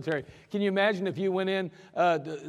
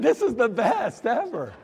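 high, laughing, pleased; laughing delight; 6 semitones higher, 5 dB louder, slower than usual. Retold or imitated. Soldier laughing to himself, thrilled to be sent out. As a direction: laugh at your lucky escape. Pleased thought after being dismissed.

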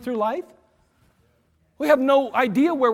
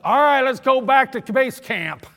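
louder, slow commanding call; lieutenant's order; 4 semitones higher, 7 dB louder, slower than usual. Retold or imitated. Lieutenant ordering his driver home, loud and slow. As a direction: give the order like an officer. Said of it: "he'd be like".

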